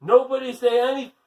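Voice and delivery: fast, loud and high warning; urgent warning. Acted. Jenny warning her classmates, fast loud urgent call. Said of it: "Jenny tried to warn".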